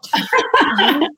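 high burst of laughter; real laugh at own joke; hearty laugh. Real, not acted. Laughs after joking answer.

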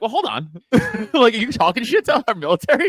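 mock-offended patriot, pitch up and laughing; half-laughing patriotic huff; indignant American. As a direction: play the outraged American who won't take it. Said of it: "I get that Americanness in me".

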